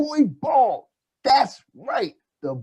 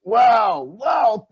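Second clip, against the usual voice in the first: high, loud wow; excited; 7 semitones higher, 4 dB louder than usual. Acted. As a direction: burst out amazed. Host reacts to report.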